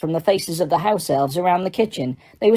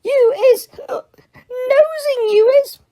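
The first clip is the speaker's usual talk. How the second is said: put-on wag a drunken finger; accusing drunk elf. A narrator's reading. Winky accuses Harry of nosing, high and tearful.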